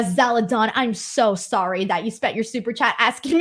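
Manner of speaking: normal talking voice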